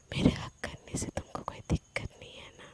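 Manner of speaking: low serious whisper. A narrator's reading. Sana asks Palak about the hug in a serious whisper. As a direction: whisper gravely, close and intimate. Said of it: "एकदम serious voice में".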